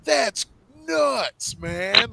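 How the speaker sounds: amazed. Retold or imitated. Retelling own amazed reaction.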